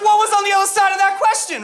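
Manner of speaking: high, loud baffled voice; mock bewildered squeal; incredulous. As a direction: ask it in stunned disbelief. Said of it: "I was like".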